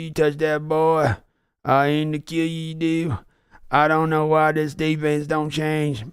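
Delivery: a streamer doing his Billy Bob Sling Blade impression on request. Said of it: "Can you do Billy Bob in Sling Blade impression".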